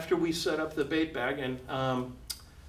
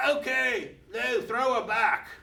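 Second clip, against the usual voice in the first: much higher, offhand put-on voice; crocodile hunter voice; 8 semitones higher than usual. Crocodile hunter casually tells Lou to release the shark, lightly voiced. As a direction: toss it off like it's nothing. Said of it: "He says".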